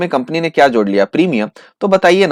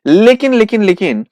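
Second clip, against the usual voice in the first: louder and higher, repeated for suspense; excited buildup; 6 semitones higher, 4 dB louder than usual. Acted. Teacher teasing a twist to students, louder and excited. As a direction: hold them in suspense with लेकिन.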